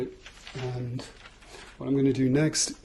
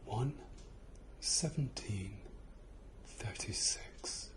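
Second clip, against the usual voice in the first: slow whispered numbers in the ear; 3 semitones lower, 14 dB quieter, slower than usual. Real, not acted. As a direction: breathe the numbers softly, close in. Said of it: "I'm gonna whisper some numbers".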